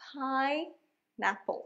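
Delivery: Pineapple stretched slowly, leaning on PAI; drawn out, first-syllable stress. Shown as a demonstration. Teacher leading pronunciation practice, pineapple drawn out. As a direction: draw out pine, then let Apple follow after a gap. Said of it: "এটা আমরা একটু টেনে বলবো".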